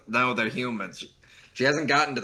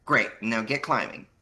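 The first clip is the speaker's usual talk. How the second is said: put-on Valley Girl voice; 5 semitones higher, 3 dB louder than usual. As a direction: play a smug Valley Girl. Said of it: "You gave her a very good Valley Girl voice".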